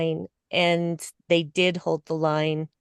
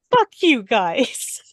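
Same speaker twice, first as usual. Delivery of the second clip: defiant mood; 3 semitones higher, slower than usual. Retold or imitated. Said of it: "that is her whole mood".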